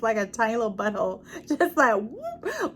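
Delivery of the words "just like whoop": comic whoop. Retold or imitated. Imitating shrinking sour mouth.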